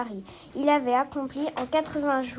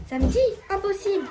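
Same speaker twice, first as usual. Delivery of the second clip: higher-pitched, disbelieving outburst; incredulous; 6 semitones higher, faster than usual. A narrator's reading. Fogg learns from Passepartout that it is Saturday, incredulous. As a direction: question it sharply, then reject it outright.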